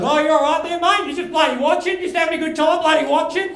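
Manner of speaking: high-pitched, aggressive bikie challenging a bystander; screaming bikie. Retold or imitated. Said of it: "this guy's screaming at these guys".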